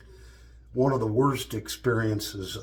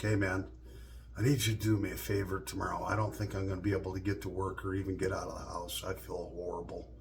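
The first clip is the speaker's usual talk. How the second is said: quiet, fast mock-sick plea, fading softer; faking sick; 4 semitones lower, 7 dB quieter, faster than usual. To brother-in-law, pretending to be too sick for work, mock-sick fading voice. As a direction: put on a quick pretend-ill voice that fades away.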